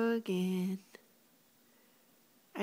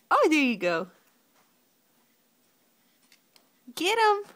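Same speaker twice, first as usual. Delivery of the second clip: high, loud excited cheering; 9 semitones higher, 8 dB louder, faster than usual. Real, not acted.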